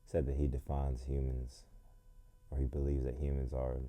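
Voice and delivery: ordinary talk, nothing marked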